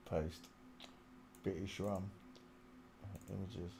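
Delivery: normal talking voice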